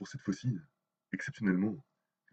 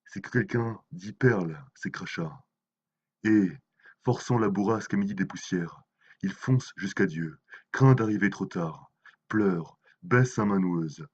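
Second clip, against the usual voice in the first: solemn, measured poem reading; dramatic poem, phrase by phrase; poetry recitation; 5 dB louder than usual. Acted. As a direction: recite the verse with weight, pausing between phrases. Recites translated poems by Mayakovsky.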